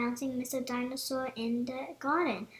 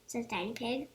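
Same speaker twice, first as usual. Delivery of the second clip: ordinary delivery, nothing marked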